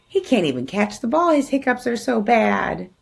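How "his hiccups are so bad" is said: plain reading voice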